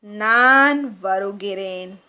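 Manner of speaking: नान वरुगिरेन said slowly, each syllable clear; slow Tamil pronunciation model for the class; slow careful demo. Shown as a demonstration. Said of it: "इसको कहेंगे".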